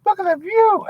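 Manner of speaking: very high falsetto delight; high-pitched mock girlfriend gasping at the view; gushing girlfriend. Wife voiced gushing over apartment.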